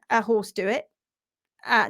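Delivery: ordinary talk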